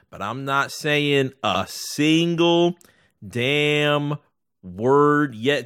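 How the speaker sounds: every word dragged out slowly; slow and deliberate. Acted. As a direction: spell it out slowly, making a point. Slow emphatic vow.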